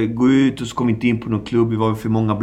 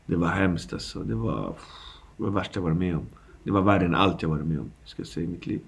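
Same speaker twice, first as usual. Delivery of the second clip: sad and quiet; 5 semitones lower, 9 dB quieter, faster than usual. Real, not acted. Telling of wife's cancer.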